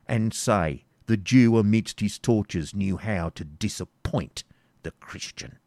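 desperate defiance. Acted. Isaac defying the baron's torture, desperate and loud. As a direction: defy him with everything. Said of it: "rendered desperate by paternal affection".

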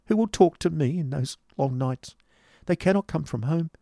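shivering, despairing cry. A narrator's reading. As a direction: build from fearful pleading to a hopeless wail. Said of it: "cried his companion, shivering".

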